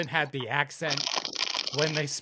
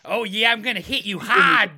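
dominatrix voice; 3 semitones higher, 8 dB louder than usual. Pretend. Mock dominatrix threat.

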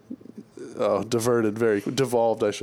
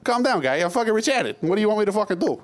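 much higher dopey mock voice, fast at the end; 8 semitones higher than usual. Host retelling warning a room in a much higher dopey voice. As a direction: brush people off like a dopey guy. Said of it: "I say".

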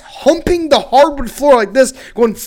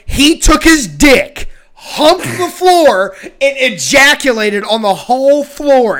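loud, slow, higher-pitched and deliberate; emphatic spelled-out explanation; 5 semitones higher, 6 dB louder, slower than usual. Retold or imitated. Explaining to the ex on the phone, slow and emphatic. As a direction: hammer out "dick", "humped" and "ejaculated" slowly.

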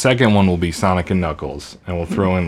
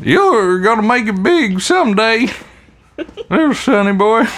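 put-on high, cracked old-timer voice; old prospector; 14 semitones higher than usual. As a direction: play a giddy gold-rush codger. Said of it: "And that's the old prospector bit".

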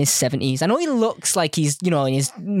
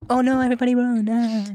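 mock panic; 6 semitones higher than usual. Mock panic over alarm.

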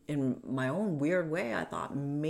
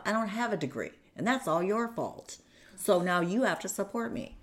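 high, emphatic retold accusation; accusing; 7 semitones higher, 4 dB louder than usual. Retelling the quarrel over money and degree, accusing. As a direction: pin the blame on the listener, pitched up.

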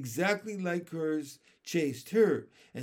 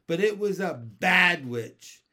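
louder, aggrieved, pointed; upset accusation; 3 semitones higher, 8 dB louder than usual. A narrator's reading. Teased girl telling on a witch, upset. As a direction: accuse with hurt emphasis.

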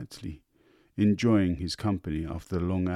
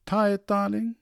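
wife's question; 13 semitones higher, 5 dB louder than usual. A narrator's reading. Wife checking on her tired husband, high. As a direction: ask him tenderly as the doting wife.

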